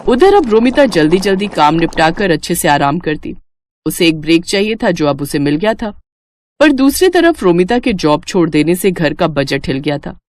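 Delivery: ordinary delivery, nothing marked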